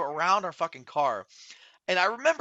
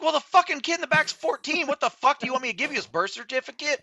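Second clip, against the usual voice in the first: higher, louder, incredulous snapping back; exasperated retort; 10 semitones higher, 4 dB louder than usual. Retold or imitated. Retelling how he snapped at the cop about IDs, exasperated. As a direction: throw the absurd question back at him.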